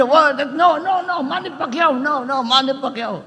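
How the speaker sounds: high, insistent mock protest; lightly voiced wife scolding her husband; indignant wife. Filipina wife insisting her husband cheer Manny, high mock protest.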